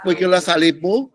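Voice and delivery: ordinary talk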